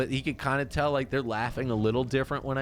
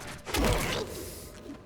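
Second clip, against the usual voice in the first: high airless laugh of relief; breathless scared laugh; 3 dB quieter than usual. Real, not acted. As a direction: laugh off the fright. Laughing after jump scare.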